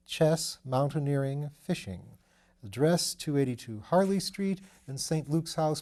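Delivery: usual speaking voice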